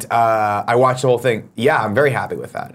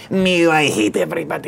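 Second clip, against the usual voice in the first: lightly voiced grumpy nerd baby; a hint of a whiny commenter; mock nerd voice; 4 semitones higher than usual. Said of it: "they had a baby and it was".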